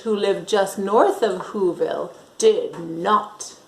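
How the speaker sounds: ordinary delivery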